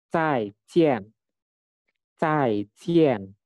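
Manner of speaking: "Zaijian" with both syllables falling sharply; fourth tone. Shown as a demonstration. Teacher models the farewell, falling fourth-tone "Zaijian". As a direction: start each half of "Zaijian" high and drop. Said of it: "Nadanya nada keempat".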